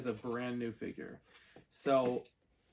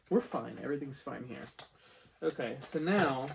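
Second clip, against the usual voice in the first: usual speaking voice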